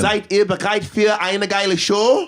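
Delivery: German announced slowly, Köln seid ihr bereit; German stage call. Retold or imitated. Singer greeting Cologne crowd in German, slow show call. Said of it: "learned a little bit of German".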